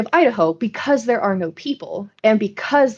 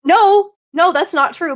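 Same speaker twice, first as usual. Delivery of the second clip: high, loud indignant denial; protesting; 8 semitones higher, 5 dB louder than usual. Real, not acted. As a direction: object sharply to being teased. Protesting a teasing accusation.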